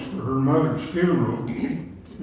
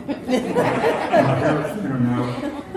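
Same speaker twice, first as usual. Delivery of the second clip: laughing; 8 semitones higher, 4 dB louder, much slower than usual. Real, not acted. Laughing about thinning hair.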